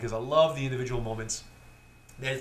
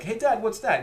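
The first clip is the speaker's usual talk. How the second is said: put-on much higher, bright child question; a kid pointing at the wine; curious kid; 9 semitones higher, 4 dB louder than usual. A kid asking dad at the dinner table, much higher. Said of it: "one of the kids is like".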